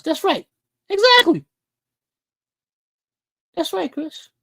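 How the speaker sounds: fired-up exactly; emphatic agreement. Real, not acted. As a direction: bark your agreement.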